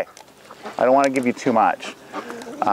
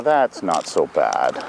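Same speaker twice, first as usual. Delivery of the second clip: soothing; 6 semitones lower, slower than usual. Real, not acted. Soothing a nervous animal.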